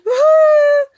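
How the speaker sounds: hammed-up woo-hoo; high, loud mock whoop. Shown as a demonstration. Acting out feelings for the women, a hammed-up whoop. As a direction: shriek it out in mock glee.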